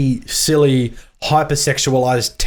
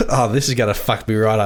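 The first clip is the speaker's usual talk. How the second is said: usual speaking voice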